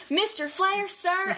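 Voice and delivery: high, excited retold greeting; starstruck address. Fan addressing her wrestling idol, retold starstruck excitement.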